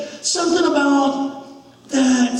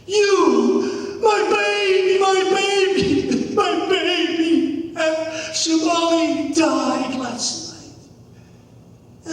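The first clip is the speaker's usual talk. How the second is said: drawn-out acted wail of grief; wailing, yelling mother; 2 semitones higher, much slower than usual. Mother yells at boy over her dead child, wailing. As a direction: cry out slowly as a bereaved mom. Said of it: "of yelling at me".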